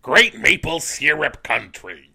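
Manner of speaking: slow, loud, strained screech; Gilbert Gottfried impression. Doing Gilbert Gottfried for the podcast crew, slow loud screech. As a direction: stretch it out in a grating yell. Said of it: "make us listen to Gilbert?".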